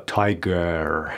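low, slow "تایگر" with a drawn-out final r; overdone mispronunciation. Shown as a demonstration. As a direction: stretch the end of "تایگر" into a long "gerrr". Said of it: "نمیگیم".

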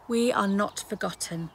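ordinary talk

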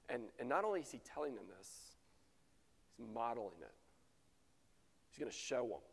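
ordinary talk

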